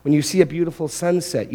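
usual speaking voice

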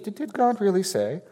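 the serpent voiced lightly, questioning; sly serpent question. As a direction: plant doubt like the serpent. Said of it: "that's how the serpent deceived Eve".

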